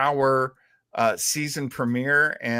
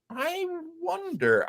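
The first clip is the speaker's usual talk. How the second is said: drawn-out, much higher, wondering tone; musing; 5 dB quieter than usual. Real, not acted. Host musing aloud to co-host about radio, wondering. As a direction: muse aloud, slowly and lilting.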